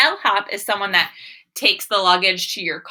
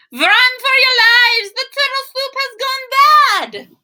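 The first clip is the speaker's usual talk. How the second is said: much higher, loud frantic alarm; panicked shriek; 8 dB louder than usual. Acted. Kitchen critters fleeing the bad soup, high panicked yelling. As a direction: scream a warning to everyone.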